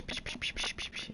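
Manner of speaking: unvoiced shushing hiss; whispered psh psh psh. Real, not acted.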